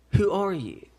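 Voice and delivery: ordinary talk, nothing marked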